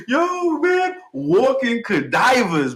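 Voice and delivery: high, slow, drawn out in amazement; hyped. Real, not acted. Hyping the rapper's line to viewers, high excited drawl. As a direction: savour it like the best line yet.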